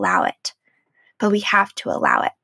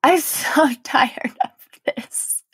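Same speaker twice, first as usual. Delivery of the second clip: slow, laughing through the words; laughing exasperation; 5 semitones higher, much slower than usual. Retold or imitated. Venting to viewers about months of unraveling, slow and laughing. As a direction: laugh it out, worn out but amused. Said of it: "And I was just like".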